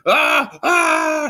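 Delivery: thrilled flying whoop; merry call. Acted. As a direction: call out joyfully mid-flight. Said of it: "she called merrily".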